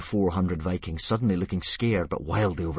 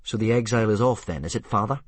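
wry son; 4 semitones higher, 6 dB louder than usual. Acted. Hiccup questioning father.

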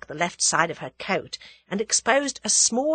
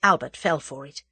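ordinary delivery

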